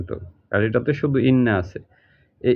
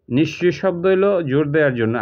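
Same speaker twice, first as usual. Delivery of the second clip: usual speaking voice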